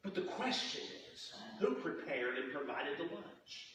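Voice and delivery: soft, slow, nearly whispered; hushed question. Acted. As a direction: draw them in with a hush. Dramatic question to congregation.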